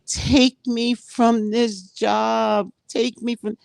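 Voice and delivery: high, very slow begging plea; pleading to God. Pretend. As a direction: beg God to take you away, drawn out.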